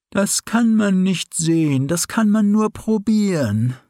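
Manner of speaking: a drowsy owl hooting wisdom; old owl voice. Acted. Sleepy owl answers the fox.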